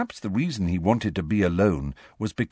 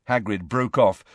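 plain reading voice

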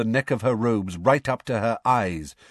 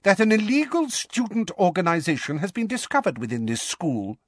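high-pitched, angry official; high, indignant accusation; thick with anger; 9 semitones higher than usual. A narrator's reading. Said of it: "his voice now thick with anger".

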